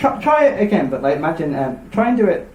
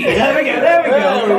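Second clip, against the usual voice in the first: ordinary talk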